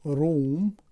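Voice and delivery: روم, long oo, in a slightly English-sounding voice; English word room. Shown as a demonstration. Teacher showing students the English room, long oo.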